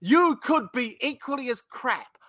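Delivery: put-on venting at the old provider; mock anger.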